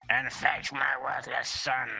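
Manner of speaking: put-on high, loud old man's order; old lord calling out. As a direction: shout it through the door as an aged lord.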